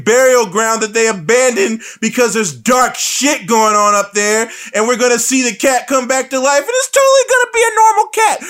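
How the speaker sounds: mock frantic shouting. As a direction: escalate into a frantic mock yell. Mocking the film's characters.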